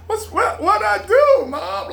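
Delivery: squeaky innocent protest to mom; high whiny son voice. Retold or imitated. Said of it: "it's like".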